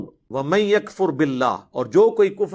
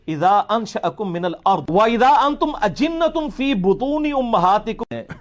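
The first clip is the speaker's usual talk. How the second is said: slow, drawn-out Arabic recitation; measured chanted tilawat; Quran recitation; 3 semitones higher, slower than usual. Acted. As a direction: recite it slowly like a qari.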